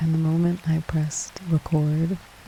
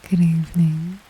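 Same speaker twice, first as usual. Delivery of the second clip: ordinary talk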